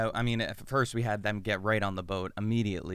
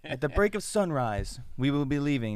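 grand announcement; 6 semitones higher than usual. Retold or imitated. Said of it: "and he's just like".

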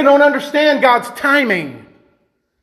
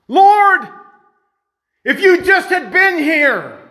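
higher, drawn-out cry to the Lord; impassioned reproach; 7 semitones higher, slower than usual. Acted. Martha comes to Jesus about Lazarus, impassioned reproach. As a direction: call out in aggrieved appeal.

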